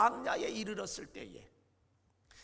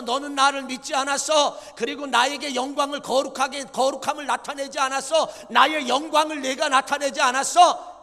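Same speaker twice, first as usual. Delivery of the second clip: loud, high divine reprimand; stern rebuke; 7 semitones higher, 16 dB louder than usual. Acted. God rebuking Moses for his unbelief, loud and stern. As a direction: thunder the charge down at him. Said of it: "모세를 향하여 하나님이 말씀합니다".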